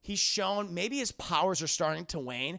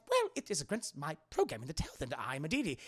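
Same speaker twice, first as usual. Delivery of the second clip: fast, fussy protocol droid protest; C-3PO; 3 semitones lower, 4 dB quieter, faster than usual. Acted. Droid refusing Han's plan, fussy objection. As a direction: object like a prim robot, quickly.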